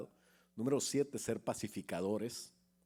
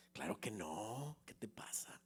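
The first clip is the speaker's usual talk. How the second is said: whisper; 2 semitones lower, 10 dB quieter than usual. Retold or imitated. Coworker whispering to the preacher about rumours, hushed.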